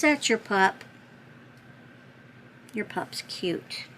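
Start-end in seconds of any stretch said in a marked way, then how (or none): 2.7-3.8 soft, slow whisper. Real, not acted. Admiring the sender's dog photo in a whisper. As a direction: breathe it out tenderly.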